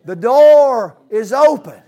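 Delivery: loud, high and slow; loud proclamation. Real, not acted. Preacher to the congregation, loud slow call. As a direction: ring it out to the church. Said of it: "What do they say".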